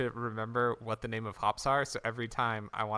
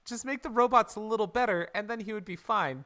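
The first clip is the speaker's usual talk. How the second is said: exasperated; 9 semitones higher, 8 dB louder than usual. Real, not acted. Exasperated rant at Shredder's plans.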